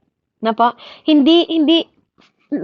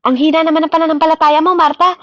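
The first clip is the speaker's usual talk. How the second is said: higher, louder, fast rebuke of Martha; mock scolding; 5 semitones higher, 3 dB louder, faster than usual. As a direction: scold her faith briskly, pretend harsh. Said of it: "Hindi niya sinabi na". (pabirong pagsaway kay Martha)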